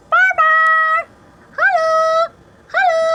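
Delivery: pet-talk shout; high-pitched calling. Real, not acted. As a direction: call the pet in a very high, loud sing-song.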